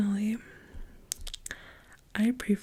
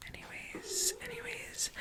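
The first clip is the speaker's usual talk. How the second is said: silly high aside; 12 dB quieter than usual. Real, not acted. Squeaky self-interrupting aside.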